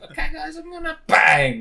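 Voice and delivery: high put-on child voice cut off by a loud bang; squeaky mock child, interrupted loudly; twelve-year-old kid. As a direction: imitate a chirpy kid about to be shot. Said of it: "this little fucking twelve-year-old".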